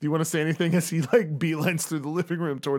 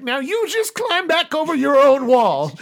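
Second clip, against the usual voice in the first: put-on elderly woman; 10 semitones higher, 7 dB louder than usual. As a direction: warn him off as a feisty old neighbour. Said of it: "and she'll be like".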